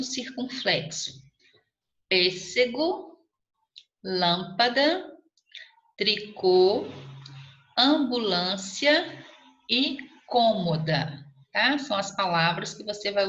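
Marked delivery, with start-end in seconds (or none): 2.1-11.2 slow dictation. Shown as a demonstration. Dictating words with circumflex.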